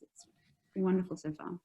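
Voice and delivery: normal talking voice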